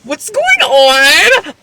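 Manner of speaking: long drawn-out, high-pitched disbelief; stretched-out cry of bewilderment; exaggerated bafflement. Real, not acted. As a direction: wail it slowly, utterly baffled. Exasperated bafflement at the story.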